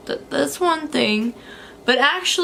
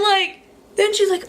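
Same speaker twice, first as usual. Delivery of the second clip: ordinary talk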